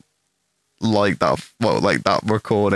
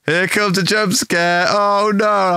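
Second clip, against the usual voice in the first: higher, slower fake dread; hammed-up mock fear; 9 semitones higher, slower than usual. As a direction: pretend to be terrified, tongue in cheek. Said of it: "And I was like".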